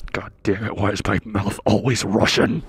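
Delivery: low, grumbled mock exasperation; retold exasperation. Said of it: "I straight up was just like".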